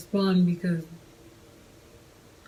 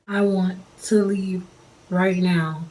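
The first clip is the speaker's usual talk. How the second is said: normal talking voice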